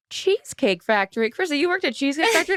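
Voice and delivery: high, quick surprised questions; imitating a dad's amazed double take; mock astonished reading aloud. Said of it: "all of a sudden he goes".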